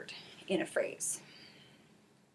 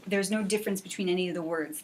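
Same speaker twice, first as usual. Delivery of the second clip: usual speaking voice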